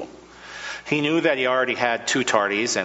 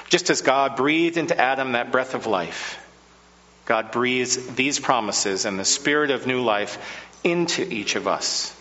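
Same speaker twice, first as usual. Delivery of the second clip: usual speaking voice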